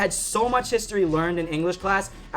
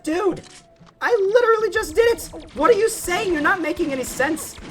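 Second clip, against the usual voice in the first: high-pitched, hammed-up frustration; retold exasperation; 13 semitones higher than usual. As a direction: protest in squeaky disbelief at a pest. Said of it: "I got so mad".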